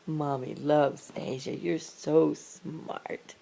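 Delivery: low, slow tender praise. Real, not acted. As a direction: lovingly praise the cat.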